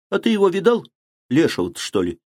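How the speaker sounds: lightly teasing question; a touch of scornful mockery; mocking. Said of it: "насмешливо перебил его Федя".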